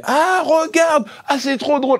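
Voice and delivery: kids' overexcited commentary, mimicked high; imitating excited kids. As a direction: squeal like children thrilled by a film. Imitates his children watching the film.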